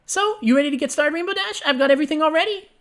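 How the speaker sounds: high, gleeful pony voice; gleeful Pinkie. Acted. Pinkie reassures her late friend, high and gleeful. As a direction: beam out every word, high and giddy.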